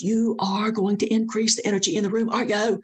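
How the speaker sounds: high-pitched imitation of an in-your-face order; mock scream. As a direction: pitch it up like someone screaming at you, without full volume. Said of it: "She's screaming at me".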